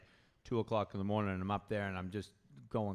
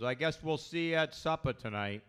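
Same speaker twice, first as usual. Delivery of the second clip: a hint of a dad on the phone, pitched up; slightly put-on higher voice of a father; lightly imitated dad; 5 semitones higher than usual. Dad phones son about supper, lightly imitated. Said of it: "my dad calls me".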